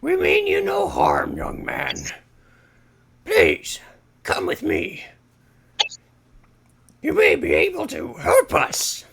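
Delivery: a grave birdman speaking to a stranger; bird warrior voice. Acted. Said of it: "a spear and he says, like".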